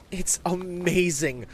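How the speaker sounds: high, drawn-out awe; excited kid savoring it; thrilled young boy. Acted. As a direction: gush slowly with wonder.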